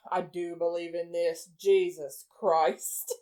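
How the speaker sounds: low, soft, unimpressed send-up; mocking Loki. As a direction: mutter it slowly in mock exasperation. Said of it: "we're, like, making fun of Loki".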